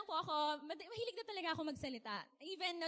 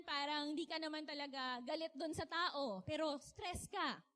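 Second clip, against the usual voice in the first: normal talking voice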